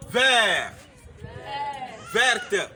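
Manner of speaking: Vert said loud as VAIR, then Verte as VAIRT with the t heard; clear model words. Shown as a demonstration. Teacher to class, masculine then feminine, loud. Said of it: "This is the feminine now".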